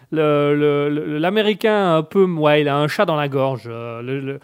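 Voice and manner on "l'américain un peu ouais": ordinary talk, nothing marked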